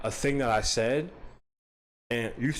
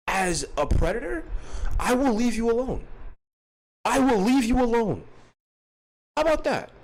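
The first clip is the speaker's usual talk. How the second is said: high pleading bargain; 7 semitones higher than usual. Pretend.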